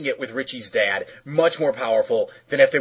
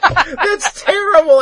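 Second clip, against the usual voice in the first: laughing; 6 dB louder, slower than usual. Real, not acted. Shocked laughing reaction.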